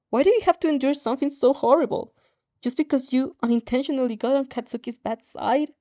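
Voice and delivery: ordinary delivery, nothing marked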